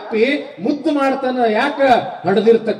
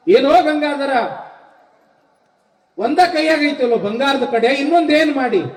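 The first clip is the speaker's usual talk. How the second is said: raised-pitch maternal demand; stern mother questioning; 6 semitones higher than usual. Acted. Mother asking her son about the missing gold bangle, demanding. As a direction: call him to account, voice pitched up.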